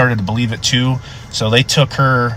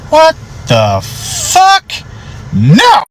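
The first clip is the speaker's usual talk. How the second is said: appalled disbelief; much slower than usual. Retold or imitated. Deputy recalling his reaction to the baby confession, appalled disbelief. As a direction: stretch it out high, aghast.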